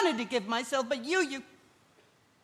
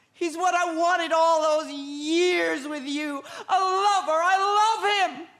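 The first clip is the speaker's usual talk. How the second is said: slow, crying declaration; tearful confession; 2 semitones higher, slower than usual. Acted. Wife confessing her love for Adam to her husband, crying. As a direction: sob out the confession.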